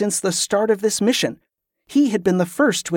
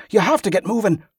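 higher, insistent urging; urgent hiss; 4 semitones higher, faster than usual. A narrator's reading. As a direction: urge them on, only lightly hissed. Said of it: "Wick hissed".

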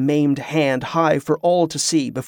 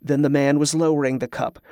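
usual speaking voice

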